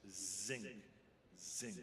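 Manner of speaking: "Zinc" with a buzzing z; voiced z. Shown as a demonstration. Coach shows the voiced z of "zinc". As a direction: say "zinc" with a vibrating s. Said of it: "one is a vibrating S".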